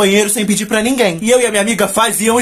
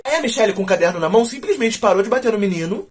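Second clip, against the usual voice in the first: normal talking voice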